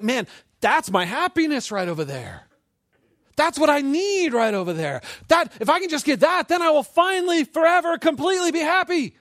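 high, loud, desperate insistence; craving inner self. Acted. Preacher acting out the heart craving happiness, frantic and loud. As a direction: build to a yelled, over-eager plea. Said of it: "everything in you is like".

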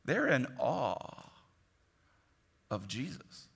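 hushed awe. Acted. Preacher telling the church of the apostles' awe, hushed. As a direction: let it fall to a reverent hush. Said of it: "slow, hushed repeat".

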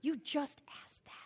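whispered, under the breath; tired whispered aside; exasperated whisper. Retold or imitated. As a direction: hiss it to yourself in frustration.

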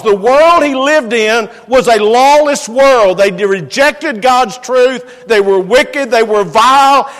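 preacher denouncing the wicked world, loud and fervent; fervent preaching. Real, not acted. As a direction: build the condemnation with force. Preaching at full force.

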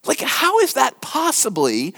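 sudden high disbelieving question; a high, puzzled how; incredulous how. Acted. Preacher voicing disbelief.

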